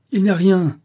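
high, forceful cry; loud exclamation. A narrator's reading. Rochester dismisses the alarm to crowding guests, exclaiming loudly. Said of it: "s'écria-t-il".

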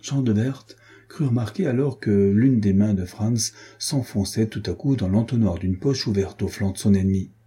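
plain reading voice

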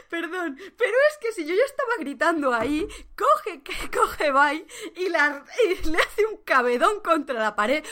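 words pushed out through laughter; giggly excited retelling; laughing while talking. Real, not acted. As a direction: keep laughing as you tell it.

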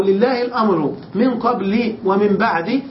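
قبل and بعد as qabli, ba'di; kasra without tanween. Shown as a demonstration. Teacher offering students the second reading, قبل and بعد ending in i. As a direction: end قبل and بعد on short i, no n. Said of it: "فقد حذف المضاف إليه ونوى لفظا معينا".

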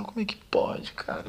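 hushed, breathless whisper of disbelief; whispered despair. Retold or imitated. To himself, reliving the night he lost hope, whispered. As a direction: whisper it like a prayer you can't believe.

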